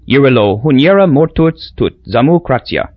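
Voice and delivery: "Yirilo Huniera Mortuts Tut Zamu Kratia" in lilting island dialect; San Lorenzan dialect. Acted. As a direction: say it the local way, foreign sounds. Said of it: "he'd said in dialect".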